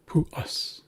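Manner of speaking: "Pu us" with an ah-like vowel in "us". Shown as a demonstration. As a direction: say "us" with uh, not eh. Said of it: "think ah".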